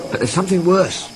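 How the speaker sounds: Toby voice. A narrator's reading.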